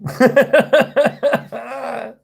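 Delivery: a long, high cackle of mockery; high mocking laugh. Real, not acted. Laughs at the trolls.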